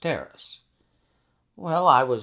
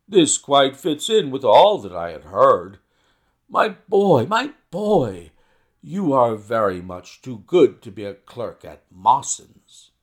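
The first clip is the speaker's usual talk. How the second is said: loud, effusive praise; admiring outburst; 8 dB louder than usual. A narrator's reading. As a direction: burst out, flattering him loudly. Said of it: "This outburst rather astonished me".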